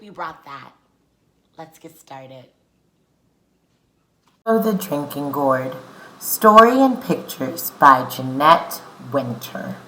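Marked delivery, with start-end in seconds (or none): 4.5-9.8 loud, clear read-aloud for the class; story reading. Acted. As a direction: read the book out to the children. Reads the book aloud.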